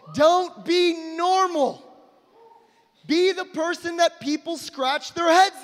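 high slow preaching shout; emphatic shout. Real, not acted. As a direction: drive it home slowly.

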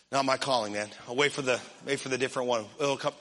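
low, soft mock brush-off; noncommittal excuse. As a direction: duck out under your breath. Acts out someone dodging a serving request.

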